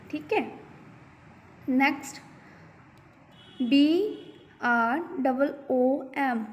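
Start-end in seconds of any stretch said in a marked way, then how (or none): none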